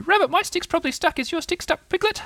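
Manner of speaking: very high, chattering little voice; fretting Roo. Acted. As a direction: pester them in a tiny high voice. Roo pestering the others.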